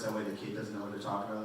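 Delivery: normal talking voice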